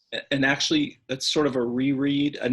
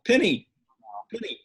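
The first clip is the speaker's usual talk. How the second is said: calling a dog; 6 semitones higher, 3 dB louder than usual. Real, not acted.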